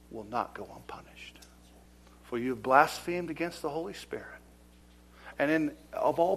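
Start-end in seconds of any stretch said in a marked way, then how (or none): 0.1-1.4 ominous whisper. Acted. Preacher warning of judgment, whispered. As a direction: drop to a threatening whisper.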